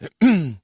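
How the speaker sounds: gruff throat clear. Acted. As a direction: clear the throat before speaking. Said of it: "clearing his throat".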